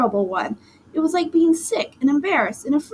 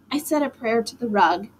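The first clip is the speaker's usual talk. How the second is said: ordinary delivery, nothing marked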